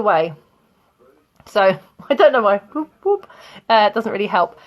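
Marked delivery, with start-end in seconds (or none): 2.6-3.3 two high little whoops; sing-song swooping sound effect; playful whoop. Shown as a demonstration. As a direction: toss out a silly high "whoop, whoop". Playful noises about swapping clauses.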